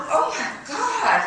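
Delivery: startled shriek; shocked gasp. Acted. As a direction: cry out in alarm. Sees man across street.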